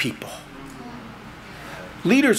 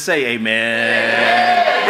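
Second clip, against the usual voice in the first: call and response; 4 semitones lower, 4 dB louder, much slower than usual. Real, not acted. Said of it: "call for response".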